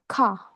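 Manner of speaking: "ka" with no R at the end, open ah; South African car. Shown as a demonstration. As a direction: say "ka" dropping the final R. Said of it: "pronounced ka".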